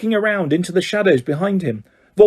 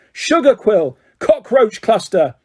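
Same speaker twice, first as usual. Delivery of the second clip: angry yell; 6 semitones higher, slower than usual. A narrator's reading. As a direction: keep yelling guesses. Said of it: "he yelled angrily".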